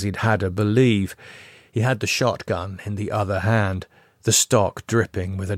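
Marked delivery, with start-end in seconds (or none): none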